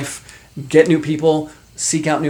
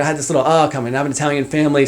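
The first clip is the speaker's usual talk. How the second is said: usual speaking voice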